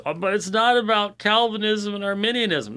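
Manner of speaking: high-pitched mimicking complaint; mock objector. Host mimics critics of his work, high mock objection. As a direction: raise the voice to parody a critic.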